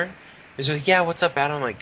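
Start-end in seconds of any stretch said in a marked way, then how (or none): 0.9-1.6 chirpy coworker. Retold or imitated. Voicing chirpy coworker's reply.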